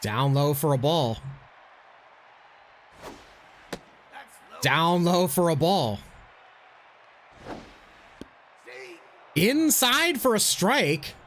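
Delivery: baseball announcer play-by-play. Acted. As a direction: call the game like a broadcaster. Plays announcer in game.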